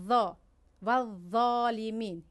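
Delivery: dark, thick th in "ظالمین", drawn out; Arabic ẓā in "ذا" and "ظالمین", tongue arched up; heavy interdental. Shown as a demonstration. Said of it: "ذا زبان در بیرون است".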